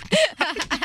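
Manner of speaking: high squealing laugh. Real, not acted. Host laughing hard.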